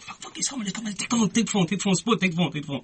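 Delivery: high, frantic gabble; retold panic. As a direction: hand the phone over in a frenzy. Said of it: "my mate panics".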